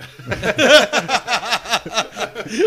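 big high burst of laughing; loud laughter. Real, not acted. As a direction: laugh hard at the joke. Hosts laugh at joke.

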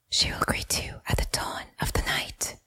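breathy, hushed whisper, almost no voice. Acted. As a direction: breathe it like a secret prophecy. Remembered words of the goddess.

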